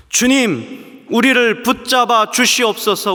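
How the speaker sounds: loud, slow pleading prayer; earnest prayer cry. Acted. A sinner begging God not to abandon them, loud slow plea. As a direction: plead loudly, drawing it out. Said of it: "우리가 이렇게 외칠 수 있는".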